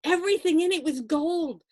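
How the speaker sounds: ordinary delivery, nothing marked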